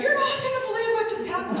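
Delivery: high, fast and laughing; excited. Retold or imitated. Husband runs into the bedroom, bursting with high excitement. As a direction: rush it out thrilled.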